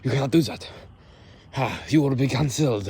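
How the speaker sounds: high, loud put-on camp outrage; camp indignant retort. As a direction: scold the accuser in a hammed-up camp voice. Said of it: "So when someone says".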